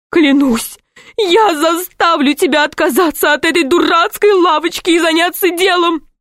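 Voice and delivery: high, tearful shrill rant; nagging wife. A narrator's reading. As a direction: rant like a furious, wronged wife. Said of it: "Низкая и суровая, Фуксовой жены".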